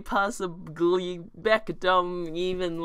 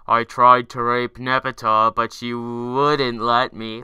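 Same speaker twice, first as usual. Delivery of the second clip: loud low Eridan voice; 5 semitones lower, 10 dB louder than usual. Acted. Voicing Eridan in the fanfic.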